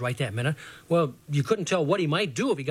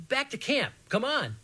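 calling back; 9 semitones higher than usual. A narrator's reading. Runner calls back to the gang.

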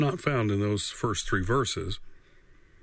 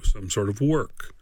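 normal talking voice

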